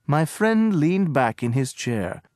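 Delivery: usual speaking voice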